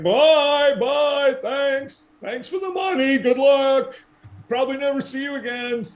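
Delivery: high sing-song goodbye; cheery high-pitched farewell. Acted. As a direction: wave them off in a chirpy voice. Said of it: "Galen's like".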